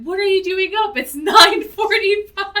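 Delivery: high, loud mock astonishment breaking into laughter; mock incredulous. Woman finding the priest up late, mock incredulous. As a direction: exclaim it in hammed-up disbelief.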